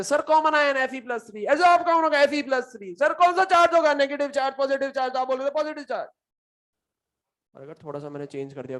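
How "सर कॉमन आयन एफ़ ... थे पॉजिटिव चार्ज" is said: higher, louder mock student shouting; imitating shouting students. Teacher mimicking students who shouted answers in class, mock shout. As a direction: ham up eager kids shouting answers. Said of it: "जोर जोर से चिल्ला रहे थे".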